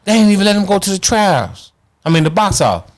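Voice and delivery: high-pitched mock wail of complaint; imitated tearful cursing. Retelling a boxer who walks up crying and cursing, distraught. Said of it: "Chris Bird walk up crying".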